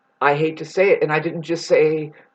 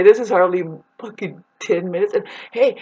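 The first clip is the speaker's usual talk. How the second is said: ordinary talk, nothing marked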